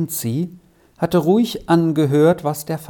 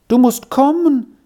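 put-on high, louder anxious child's plea; 10 semitones higher, 5 dB louder than usual. A narrator's reading. Child fetching the crying brother, anxiously. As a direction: urge him along in a high, worried voice. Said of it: "sagte Steffeli ängstlich".

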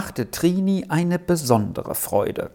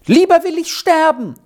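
put-on much higher, louder girl's cry; defiant outburst; 14 semitones higher, 5 dB louder than usual. A narrator's reading. A girl refusing her grandmother's plan, high defiant outburst. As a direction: blurt it out, hot and high. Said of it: "fuhr Trini augenblicklich heraus".

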